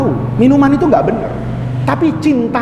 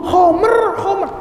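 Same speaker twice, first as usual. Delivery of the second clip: insistent shout; 7 semitones higher, slower than usual. Acted. Villagers insist it is liquor.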